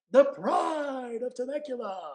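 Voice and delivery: Bruce Buffer. Retold or imitated. Introducing the fighter's hometown in ring-announcer style. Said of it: "my best Bruce Buffer impression".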